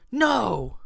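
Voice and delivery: high, irritated denial; growing anger. A narrator's reading. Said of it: "Kat was becoming angry".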